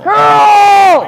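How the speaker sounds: loud, high command to the sweepers; curling skip yelling. Real, not acted. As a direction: yell curl down the sheet. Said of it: "I was yelling curl".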